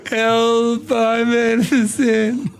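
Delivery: slow, sweetly pleading innocence; innocent voice. Pretend. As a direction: sound like a wronged little angel, dragging it out. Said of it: "You can hear it in my voice".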